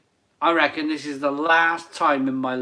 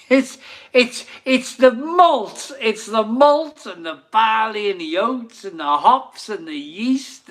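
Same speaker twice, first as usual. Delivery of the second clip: high, loud excited list of ingredients; excited joking; 7 semitones higher, 4 dB louder than usual. Real, not acted. Host joking to viewers about his hair, excited. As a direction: build it up like a miracle cure.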